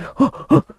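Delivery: sharp high huffs of effort; imitated puffs of rocking hard; effortful huffs. Student rocking a chair to break it, mock effortful huffing.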